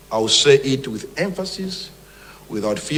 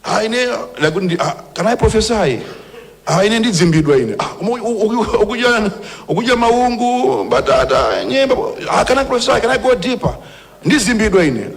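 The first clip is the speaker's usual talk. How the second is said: high mock whine, hammed-up; a high, hammed-up parody of a false prophet; mocking a constipated prophet; 8 semitones higher than usual. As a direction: lampoon him in a much higher, squeaky voice. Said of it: "Now, you can't say kuti".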